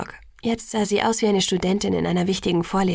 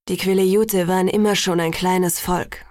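plain reading voice

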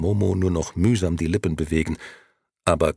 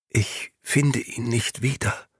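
usual speaking voice

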